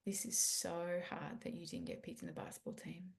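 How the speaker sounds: put-on soft, near-whispered comfort for a hurting child; gentle comforting. Shown as a demonstration. Parent kneels to comfort son who wasn't picked, soft near-whisper. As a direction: hush it down and soothe, like kneeling beside him. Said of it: "take a deep breath, get down low and go".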